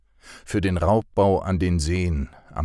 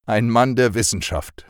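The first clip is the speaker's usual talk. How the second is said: higher, louder teasing jab; punning wisecrack, pitched up and loud; joking quip; 6 semitones higher, 6 dB louder than usual. Acted. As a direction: tease him with a mock-grand title. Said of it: "kalauerte Fred".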